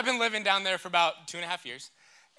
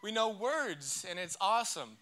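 mock amazement; 5 semitones higher, 5 dB louder, slower than usual. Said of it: "I'm like".

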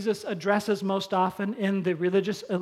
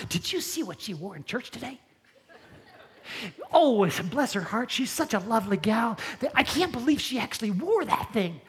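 catty gossip; 3 dB quieter than usual. Retold or imitated. Gossip mocked after sermon point.

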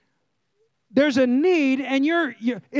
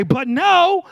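higher, louder sassy "But no."; sassy attitude; 6 semitones higher, 4 dB louder than usual. Shown as a demonstration. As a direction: throw it out with some attitude. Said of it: "say no like you got some attitude".

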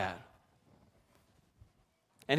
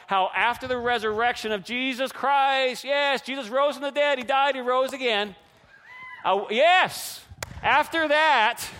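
raised, rousing preacher's voice; jubilant proclamation; 6 semitones higher, 4 dB louder than usual. Real, not acted. Preacher announcing the resurrection to the congregation, jubilant. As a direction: proclaim it high and emphatic.

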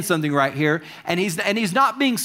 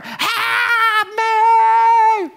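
high, loud desperate scream for help; screaming plea; 4 dB louder than usual. Retold or imitated. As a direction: shriek it at the disciples, frantic. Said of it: "screaming in their ear".

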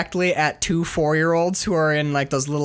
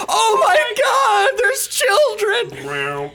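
very high, stretched-out panicked shriek; mock horrified scream; 3 dB louder, much slower than usual. Host as the driver spotting children ahead, mock horrified scream. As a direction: scream it slowly in hammed-up horror.

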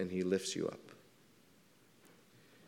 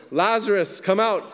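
high, loud call into the tomb; loud cry; 8 semitones higher, 6 dB louder than usual. A narrator's reading. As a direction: call Lazarus out with full force. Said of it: "Jesus cried out with a loud voice".

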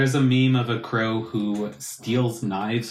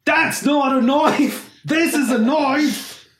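mock Australian; 13 semitones higher, 5 dB louder, slower than usual. Famous Crocodile Dundee quote.

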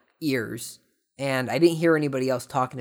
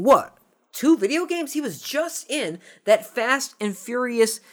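"What? Two video games?" much higher, squeaky and incredulous; mock disbelief; 10 semitones higher than usual. Vlogger voices the viewer's mock shock at the news.

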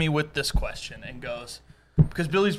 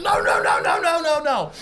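high-pitched rapid yelling imitation; hammed-up squabbling yell; mock screaming; 9 semitones higher than usual. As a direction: imitate friends shouting over each other. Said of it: "screaming at each other".

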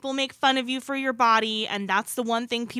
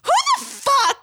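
high, loud disbelieving outburst; outraged shriek; 5 dB louder than usual. Real, not acted. Podcaster reacting to the movie line with a shocked shriek.

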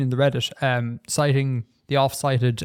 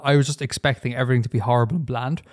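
usual speaking voice

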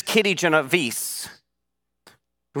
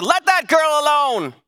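man's shout; 8 semitones higher than usual. Acted. Neighbor shouting down at the attacker, acted protective shout. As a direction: call it out from an apartment window. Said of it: "the man's voice shouted".

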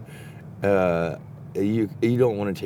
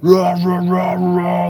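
loud, higher mock revving "rah rah"; imitated engine revving; 7 semitones higher, 5 dB louder, much slower than usual. Telling his friend how the neighbor tore up on his quad, loud mock revving. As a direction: rev it out loud like a wild quad rider. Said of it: "this fucking maniac's just like".

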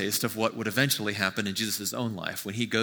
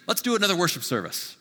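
much higher, louder, bright mock distraction; mock flighty distraction; 10 semitones higher, 5 dB louder, faster than usual. Preacher mocking a distractible god, hammed-up and breezy. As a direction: toss it off like a god waving a shiny toy. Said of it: "Nor is it a God that says".

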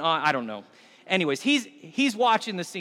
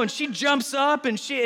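usual speaking voice

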